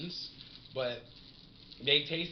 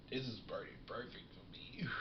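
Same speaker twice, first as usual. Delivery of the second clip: breathless whisper holding back a cough; strained whisper; 8 dB quieter than usual. Real, not acted. Just inhaled, about to cough.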